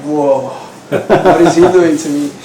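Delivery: surprised laughing exclamation; retold surprise. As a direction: relive the shock with a laugh. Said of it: "I was like".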